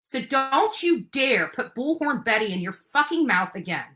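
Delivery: ordinary talk, nothing marked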